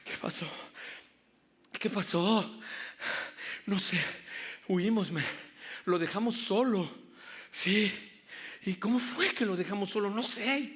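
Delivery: discípulos jadeantes y agitados, voz alta; jadeando. Acted. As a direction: habla sin aliento, como tras huir corriendo. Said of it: "jadeando así de". (sin aliento y alterado)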